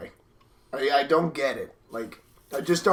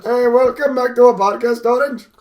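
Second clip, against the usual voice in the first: high-pitched put-on Indian accent; mock Indian accent; 7 semitones higher, 6 dB louder than usual. Podcaster welcoming listeners to the show, mock Indian accent. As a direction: play a podcast host with a jokey Indian accent. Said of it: "to do an Indian accent".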